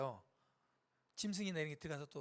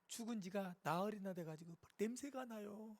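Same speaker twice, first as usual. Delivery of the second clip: higher, plaintive objection; protesting sister; 4 semitones higher, 4 dB louder than usual. Acted. Sister objecting to opening her brother's tomb, dismayed protest. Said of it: "마리아가 얘기합니다".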